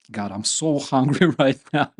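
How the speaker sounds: real laugh breaking through the words. Real, not acted. To the viewers, laughing at his own hunger, real laughing. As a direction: laugh through "so hungry", then let it out. Laughs while saying he's hungry.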